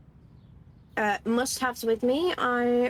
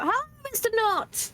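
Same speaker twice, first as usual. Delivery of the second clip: calling out; 11 semitones higher than usual. Acted. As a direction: call out brightly to him. Character calling out to landlord.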